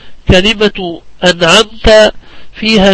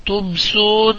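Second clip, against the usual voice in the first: ordinary talk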